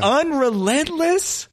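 Unrelentless? stretched slow with a high rising question tune; incredulous echo. Acted. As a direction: repeat the made-up word in mock disbelief. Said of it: "why is he so unrelentless".

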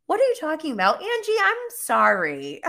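mocking Jen's innocence. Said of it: "she's like".

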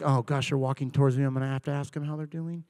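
low, hushed aside to self; acted dread. Churchgoer to self as negative person approaches, quiet dread. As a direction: say it under your breath, low.

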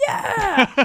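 high, drawn-out mock cheer; imitated childish hurrah; kids cheering. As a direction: whoop it like delighted kids. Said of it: "And we were like".

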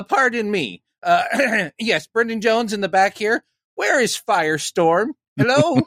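high, eager fanboy at a panel; impression of himself. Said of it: "Is that a good impression of me?".

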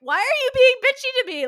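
outraged shriek. Retold or imitated. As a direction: squeal it like you can't believe his nerve. Retelling outrage at the agent.

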